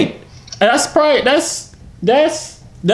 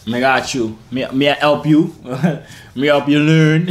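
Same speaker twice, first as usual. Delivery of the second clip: slow, lower Jamaican patois; mock Jamaican accent; 3 semitones lower, slower than usual. YouTuber offers a Jamaican lesson, slow Jamaican accent. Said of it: "do another part two of the Jamaican one".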